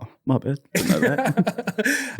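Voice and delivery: high, drawn-out "didn't know that"; mock surprise. Teasing about fake family, a high, slow mock surprise. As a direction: act surprised at the news, slowing way down. Retold realization.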